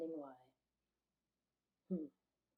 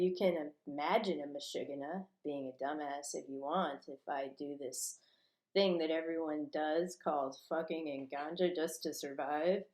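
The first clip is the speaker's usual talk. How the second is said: ordinary talk, nothing marked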